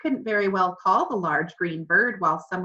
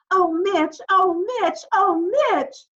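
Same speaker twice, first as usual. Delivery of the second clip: put-on much higher, loud girl's scream; screamed in Cassie's voice; 12 semitones higher, 7 dB louder than usual. A narrator's reading. Parrot screaming down from the ceiling in a girl's voice, high and loud. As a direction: shriek his name like a girl in distress. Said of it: "Julius screamed in Cassie's voice".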